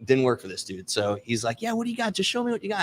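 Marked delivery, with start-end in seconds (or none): 1.6-2.8 high mocking voice of the guy; guy at the window. Salesman mimics the guy demanding to see the goods, high. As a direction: pitch it up and demand it.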